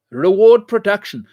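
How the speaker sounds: loud deliberate slogan; emphatic slogan. Real, not acted. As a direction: bang the slogan home. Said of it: "I'll keep saying this".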